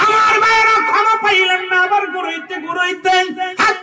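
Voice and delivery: high, loud sermon cry; full-force preaching. A narrator's reading. Preacher tells of the son's return to the grave, high loud preaching. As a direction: keep the narration pitched high and urgent.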